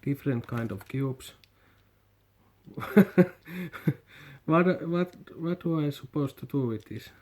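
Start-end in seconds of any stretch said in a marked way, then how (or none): none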